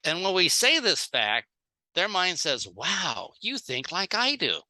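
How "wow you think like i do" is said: ordinary talk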